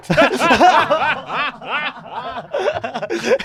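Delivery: loud high laughter; bursting out laughing; big laugh. Real, not acted. Group cracking up.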